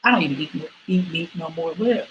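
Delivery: ordinary delivery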